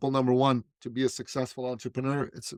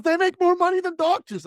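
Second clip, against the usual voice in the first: much higher and louder, eager; excited insistence; 7 dB louder than usual. Retold or imitated. Client insists to his coach, high and eager. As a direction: blurt it out like a winning argument.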